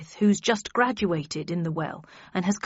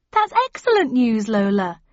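impressed; 2 semitones higher, 7 dB louder than usual. A narrator's reading. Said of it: "genuinely impressed".

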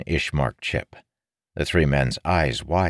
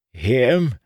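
confused beggar; 10 semitones higher, 5 dB louder than usual. A narrator's reading.

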